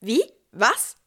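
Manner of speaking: quick rising startled questions; surprised. Acted. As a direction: react stunned to the news. Startled at shepherds' news. (verblüffte Rückfragen)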